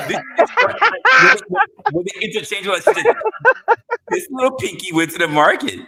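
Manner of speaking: laughing through the words, high and loud; cracking up while teasing. Real, not acted. Laughing at the co-host's pinky story.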